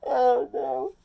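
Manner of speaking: high, loud hammed-up wail of despair; mock crying. The director, head in hands, wails in mock crying. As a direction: play the director sobbing in his hands. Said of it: "he's crying".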